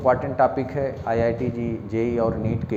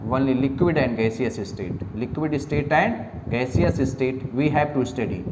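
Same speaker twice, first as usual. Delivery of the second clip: very slow, each word spaced for dictation; slow dictation pace for note-taking; much slower than usual. Shown as a demonstration. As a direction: dictate slowly so students can write. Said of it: "dictation to students".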